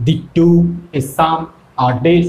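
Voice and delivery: "thee to a summer day" low, very slow, beat by beat; drawn-out weak-strong pulses on "thee to a summer day"; iambic stress. Shown as a demonstration. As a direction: scan "thee to a summer day", leaning on to, sum and day. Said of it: "যেখানে জোরে হচ্ছে".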